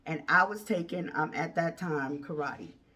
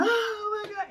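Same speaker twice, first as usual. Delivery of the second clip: mock scream; 14 semitones higher than usual. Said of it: "He's screaming".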